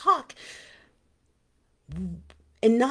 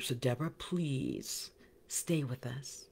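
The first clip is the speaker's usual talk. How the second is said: slow, softer, whispered; whispered plea; 5 semitones lower, 10 dB quieter, slower than usual. Real, not acted. Host softly begging Deborah to stay in the group. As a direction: coax her to stay.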